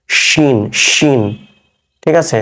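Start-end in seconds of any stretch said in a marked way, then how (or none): 0.1-1.2 slow, drawn-out "শিন" with a palatal sh; palatal pronunciation. Shown as a demonstration. Teacher showing learners the palatal letter, slow palatal sh in "শিন". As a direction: say "শিন" with the tongue behind the alveolar ridge. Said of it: "আপনার ওই প্যালাটাল অংশ থেকে".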